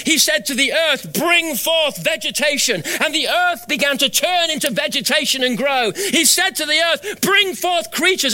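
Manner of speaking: high, ringing declamatory sermon; full-force preaching. Acted. God commanding the earth to bring forth, preached at full force.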